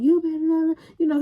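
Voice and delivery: high, loud warning voice; mock warning. Friends warning her, high and loud.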